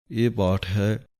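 usual speaking voice

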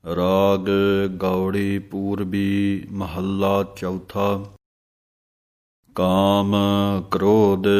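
slow, chanted scripture reading, sung at the end; slow devotional chant; Gurbani recitation. Acted. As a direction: recite the verse reverently, word by word.

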